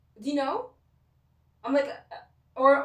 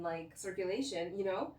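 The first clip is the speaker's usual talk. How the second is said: ordinary delivery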